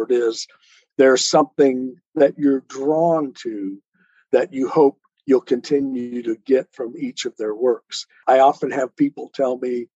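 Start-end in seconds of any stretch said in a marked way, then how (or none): none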